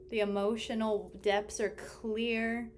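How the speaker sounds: ordinary talk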